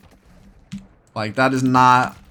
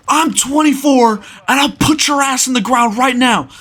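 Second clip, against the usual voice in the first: high, loud mock tirade; retold furious threat; 10 semitones higher, 3 dB louder than usual. Older man threatening the teenage storyteller, high furious rant. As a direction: rant like an enraged man, pitched way up.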